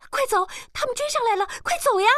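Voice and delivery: very high, urgent plea; panicked cry. A narrator's reading. Girl climbs on the cart fleeing pursuers, panicked cry. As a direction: cry out as if being chased. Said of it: "叫道".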